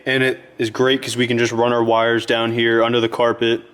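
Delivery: ordinary talk, nothing marked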